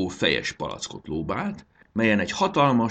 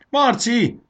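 high, loud urgent call; panting; 8 dB louder than usual. A narrator's reading. Fanny calls Marci mid-battle, urgent and winded. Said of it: "lihegett Fanny".

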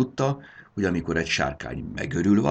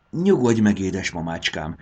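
ordinary delivery

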